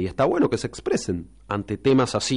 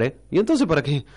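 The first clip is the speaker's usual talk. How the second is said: normal talking voice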